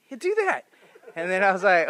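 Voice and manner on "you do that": squeaky, much higher little pet voice; guinea pig voice. Retold or imitated.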